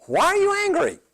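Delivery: very high, pleading why are you angry; God questioning. Retold or imitated. God asking Cain why he is angry, high and pleading. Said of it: "God questions him and says".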